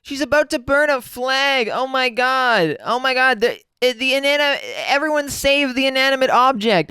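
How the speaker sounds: a hammed-up oh my God; mock shock. Real, not acted. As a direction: play up the horror over a flag. Watching the flag burning clip.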